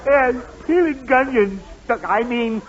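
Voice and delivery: laughing. Acted. Husband laughs at mix-up.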